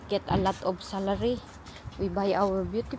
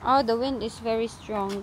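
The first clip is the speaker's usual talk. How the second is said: ordinary talk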